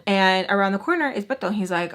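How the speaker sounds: ordinary talk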